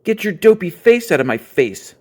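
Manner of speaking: loud, high angry snarl. A narrator's reading. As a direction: snarl it at her. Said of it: "snarled brother".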